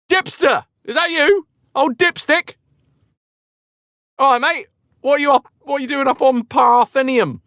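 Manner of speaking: high, loud, chummy god voice; Pan voice. Retold or imitated. God Pan hailing the runner by name, high and matey. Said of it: "He was like".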